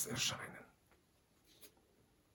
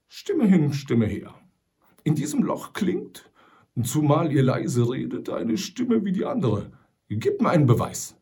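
put-on louder, higher, suspicious old trapper; 4 semitones higher, 7 dB louder than usual. A narrator's reading. Sam Hawkins distrusts the voice outside the hole, wary. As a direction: demand proof, gruff and wary.

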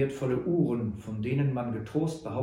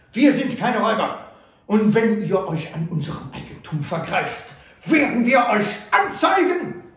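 higher, louder furious protest; outraged shout; 7 semitones higher, 7 dB louder than usual. A narrator's reading. Butler shouts at Sam for emptying their pockets, outraged. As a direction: explode at the robbery.

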